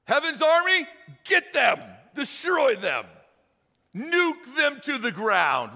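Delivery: shouted high and loud, calling down heaven's army; mock battle cry. Preacher mocking what we would do, shouting high and loud. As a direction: yell it like a vengeful warrior. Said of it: "does what you and me would do".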